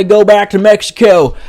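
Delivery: hammed-up Trump shout; Trump impression. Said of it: "if I was Trump".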